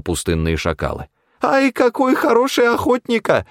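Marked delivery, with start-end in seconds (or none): 1.4-3.5 put-on very high, accented falsetto wail; wailing lament. A narrator's reading. Джамшит fussing over the hunter's kill, high wailing. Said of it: "причитал Джамшит".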